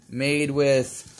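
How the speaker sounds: usual speaking voice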